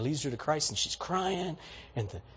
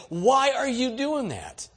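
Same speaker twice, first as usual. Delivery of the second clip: slow, higher, wondering question; puzzled stranger; 8 semitones higher, 3 dB louder, slower than usual. Shown as a demonstration. Imagined stranger reacting to kindness, slow and puzzled. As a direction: ask it like a baffled stranger. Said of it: "the response will be".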